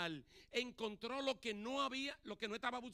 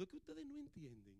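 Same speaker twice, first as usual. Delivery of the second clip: softly chiding, half to himself; soft rebuke; 5 semitones higher, 9 dB quieter than usual. Retold or imitated. Jesus gently rebuking his disciples, softly. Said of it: "Él le está diciendo".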